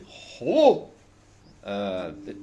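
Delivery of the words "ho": "ho" high and much louder; mock Danish sound. Comedian mimicking Danish pronunciation, loud high "ho". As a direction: yelp "ho" like a comic Dane. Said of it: "they say".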